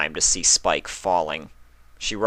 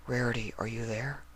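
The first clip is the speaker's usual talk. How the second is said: much softer far-off call; calling from somewhere far away; faint distant voice; 4 semitones higher, 10 dB quieter than usual. Acted. Spike calling to Rarity from the void, softly.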